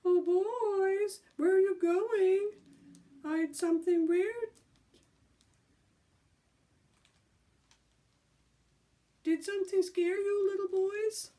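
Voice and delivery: high, loud mock girly call; sing-song taunting falsetto; shrill girlish voice. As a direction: tease them like a silly girl. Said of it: "called in a shrill, girlish voice".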